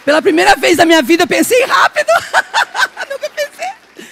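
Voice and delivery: high, loud, fast laughing boast; proud and giddy; excited laughing. Real, not acted. Excited about quick reply. (empolgada rindo)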